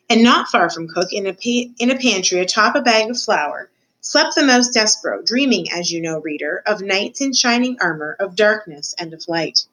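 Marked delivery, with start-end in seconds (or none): none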